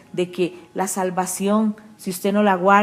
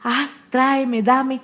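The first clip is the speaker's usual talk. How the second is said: demanding prayer; 3 semitones higher, much slower than usual. Retold or imitated. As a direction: play the greedy believer's prayer, half-mocking. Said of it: "a pedir, a pedir".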